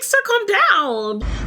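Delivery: high, drawn-out plea to settle down; exasperated appeal. Retold or imitated. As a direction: stretch it out high, exasperated at him. Acts out telling him off.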